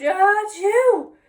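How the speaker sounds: loud high sneeze; huge sneeze. A narrator's reading. Wilbur sneezing in the little girl's room, loud sneeze.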